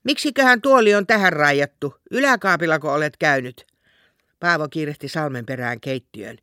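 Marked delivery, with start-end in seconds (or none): 0.0-3.6 raised, higher voice calling out from another room; calling from the kitchen. Acted. Salme calls to Paavo from the kitchen, raised voice. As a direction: call it out loud from the kitchen. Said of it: "huusi sieltä".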